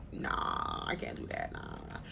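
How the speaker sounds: hushed, breathy nah, I can't; whispered refusal. Retold or imitated. To her best friend Sam, a whispered shy refusal. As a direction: whisper it, turning down the offer shyly.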